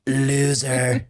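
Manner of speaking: lightly hammed-up Donald taunts; Trump impression. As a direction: list the insults smugly. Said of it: "It's always been his approach".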